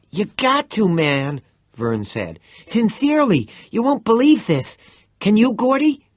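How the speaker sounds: very high, loud breathless lisp; bursting with news, lisping "Sincerely"; excited lisping Vern. A narrator's reading. Vern pleading eagerly.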